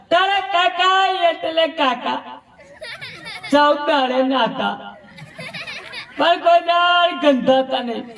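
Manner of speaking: very high, slow, wailing widow's lament; mock crying widow. The widow laments her husband to the village, in a high, slow, mock wail. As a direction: wail the praise like a hammed-up grieving widow. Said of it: "એ રડતા રડતા કહેતા".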